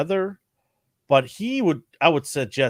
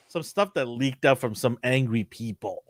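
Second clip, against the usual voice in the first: ordinary talk, nothing marked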